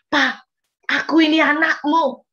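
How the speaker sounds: loud, slow, high, sure prayer; confident prayer. Retold or imitated. Mother praying to God as her father, confident. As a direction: pray as a trusting child. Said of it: "Saya bisa doa dengan yakin banget".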